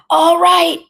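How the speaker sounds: very high, loud, enraged shout; furious yell. A narrator's reading. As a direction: yell it as a father at breaking point. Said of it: "he yelled".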